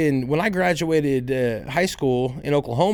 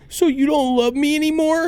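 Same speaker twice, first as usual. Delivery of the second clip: mock kid whine; 11 semitones higher, 4 dB louder than usual. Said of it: "she hits me with".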